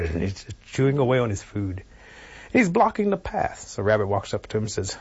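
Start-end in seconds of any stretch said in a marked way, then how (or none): none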